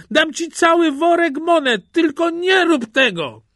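high, loud begging cry; shrieked plea from a trapped man; desperate pleading. A narrator's reading. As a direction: bargain frantically at the top of the voice. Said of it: "wołał Kościelny".

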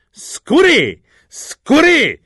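high, loud exclamations; sharp high shouts; shouted cry. A narrator's reading. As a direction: yell each word separately, falling at the end. Mocking cries while beating the seller.

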